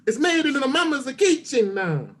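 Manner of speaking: broad, hammed-up Italian accent; sing-song mock Italian; Italian impression. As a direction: do a cartoonish Italian cook's accent. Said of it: "magnificent Italian impression".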